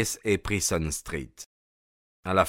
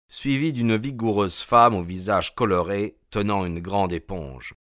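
ordinary delivery

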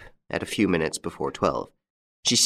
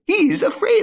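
even higher, louder, rising shriek of glee; shrieking taunt; 6 dB louder than usual. A narrator's reading. Widow repeating her taunt at Lupin, shrieking higher. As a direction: push it to a shrill peak of triumph.

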